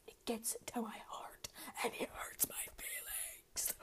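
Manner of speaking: dramatically wounded, breathed out; mock hurt whisper. Mock hurt over teacher comment.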